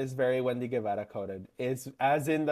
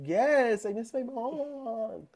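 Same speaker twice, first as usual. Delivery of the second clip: high, drawn-out plaintive whine; hammed-up homesick wail; mock whining; 10 semitones higher, much slower than usual. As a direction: whine it like a homesick kid, stretching the end. Retells own plaintive answer to friend.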